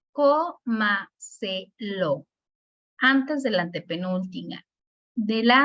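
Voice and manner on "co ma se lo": co, ma, se, lo as four separate beats, fading; syllable by syllable. Shown as a demonstration. Teacher counting four syllables, co, ma, se, lo said one by one. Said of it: "Estas tienen cuatro sílabas".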